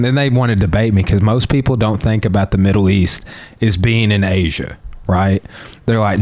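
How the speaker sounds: ordinary delivery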